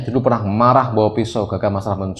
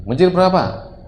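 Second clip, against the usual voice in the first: mock stern interrogation; 8 semitones higher, 3 dB louder than usual. Father interrogates son in story.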